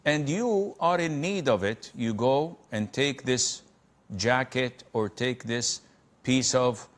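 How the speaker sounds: ordinary talk, nothing marked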